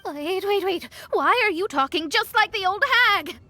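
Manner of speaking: high-pitched, flustered objection; startled protest. Acted. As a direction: cut in, alarmed and indignant.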